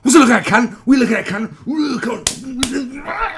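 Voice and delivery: high, very loud furious screech; screaming, drunken-pub aggression falling apart into garble; angry short man. Acted. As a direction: explode over a mere glance. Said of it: "The angry short man".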